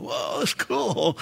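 slow, soft mock amazement; starstruck fan. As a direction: play an impressed fan, drawn out. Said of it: "and you're going".

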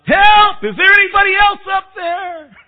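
very high, frantic comic shout; calling for help. Acted. Man on cliff rejects God's answer, shouting for help. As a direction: bellow up for anyone else at all. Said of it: "And he called out".

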